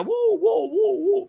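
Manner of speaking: high, light imitated woofs; gentle high-pitched mock barking; nice dog bark. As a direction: woof politely in a high voice. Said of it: "very nicely".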